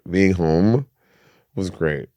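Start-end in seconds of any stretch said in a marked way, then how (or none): none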